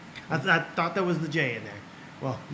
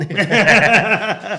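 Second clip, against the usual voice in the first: loud, high real laugh; can't hold the laugh in; burst of laughter; 6 semitones higher, 11 dB louder than usual. Real, not acted. Laughs at Back to the Future.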